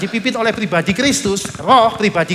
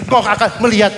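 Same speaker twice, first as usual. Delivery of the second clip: ordinary talk, nothing marked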